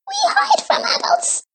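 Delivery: very high, fast little creature; squeaky creature voice. A narrator's reading. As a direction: chirp it out like a tiny forest creature. First Gleek speaks.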